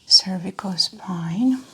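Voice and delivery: ordinary delivery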